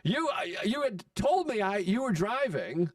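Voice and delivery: acted-out high-pitched argument; indignant rant. Acted quarrel with angry buddy.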